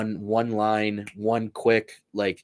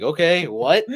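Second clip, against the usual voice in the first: higher, louder confused reaction; mock baffled; 6 semitones higher, 7 dB louder, slower than usual. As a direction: act thrown off by a weird insult. Baffled reaction to trash talk.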